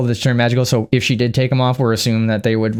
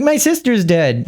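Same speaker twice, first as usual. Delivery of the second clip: mock grief; 8 semitones higher, slower than usual. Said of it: "And she's like".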